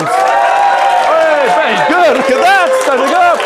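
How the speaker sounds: high, loud praise over the uproar; whooped-up, full-voiced praise; excited cheering. Real, not acted. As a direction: shout your delight to a cheering crowd. Cheers the crowd going crazy.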